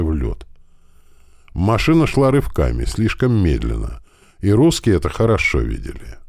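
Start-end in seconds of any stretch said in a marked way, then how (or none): none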